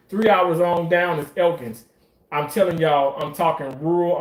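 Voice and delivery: ordinary delivery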